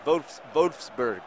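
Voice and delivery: Wolfsburg slow with a German V; drawn-out German Wolfsburg; careful mock German; German pronunciation. Shown as a demonstration. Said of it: "I'm trying to practice my German".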